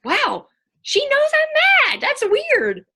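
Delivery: very high, loud, slow mock-kid amazement; squeaky astonished child voice; surprised kid. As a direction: play a kid stunned that mom gets it. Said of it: "they get surprised".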